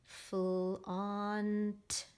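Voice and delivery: "flaunt" stretched sound by sound; slow phonics segmenting; tapped out. Shown as a demonstration. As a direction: draw out "flaunt" as f-l-au-n-t. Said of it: "I'm just gonna tap out flaunt".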